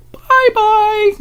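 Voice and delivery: very high sing-song bye-bye; cutesy high-pitched farewell from a dying phone; phone saying goodbye. Acted. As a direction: voice the phone mockingly waving off. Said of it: "my phone's like".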